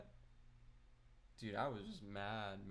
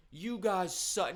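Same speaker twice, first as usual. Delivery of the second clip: mock angry; 7 semitones higher, 8 dB louder, slower than usual. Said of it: "I didn't even have to say".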